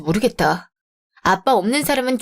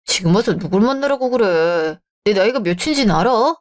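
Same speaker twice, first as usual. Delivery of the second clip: ordinary talk, nothing marked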